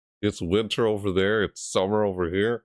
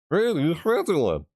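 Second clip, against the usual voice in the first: mock rating announcer; 10 semitones higher than usual.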